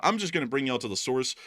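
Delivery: ordinary talk, nothing marked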